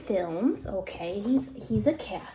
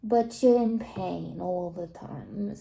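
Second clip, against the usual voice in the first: ordinary talk, nothing marked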